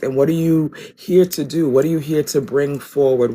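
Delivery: ordinary delivery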